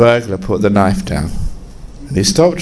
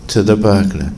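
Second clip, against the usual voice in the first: ordinary talk, nothing marked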